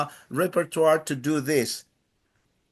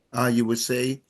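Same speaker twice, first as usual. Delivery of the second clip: ordinary talk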